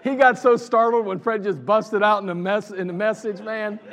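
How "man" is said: ordinary talk, nothing marked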